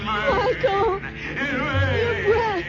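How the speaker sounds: high sobbing panicked cries; terrified crying out. Acted. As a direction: scream through tears of fear. Bride cries out in terror.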